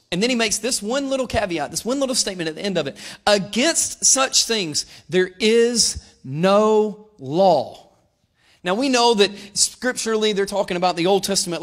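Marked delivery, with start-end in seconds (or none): 3.3-7.8 scripture reading. Acted. Reads scripture slowly.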